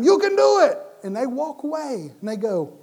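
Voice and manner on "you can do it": much higher and louder shout; high cheering yell; screamed encouragement. Retold or imitated. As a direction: scream it to fire them up. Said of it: "you scream at them".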